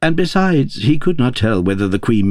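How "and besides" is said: plain reading voice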